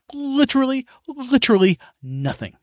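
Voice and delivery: "literally" jumps way up on the first one, then "literally nothing" hammered; emphatic literally. Real, not acted. Venting to listeners about grandpa, stressing "literally" high. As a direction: hit "literally" hard in disbelief.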